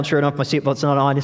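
usual speaking voice

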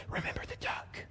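menacing unvoiced whisper. Sister whispering a threat to her brother as she passes. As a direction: hiss it under your breath as a threat.

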